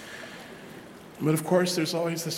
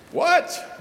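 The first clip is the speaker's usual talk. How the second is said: mock shock; 6 dB louder than usual. Mock shocked parishioner reaction.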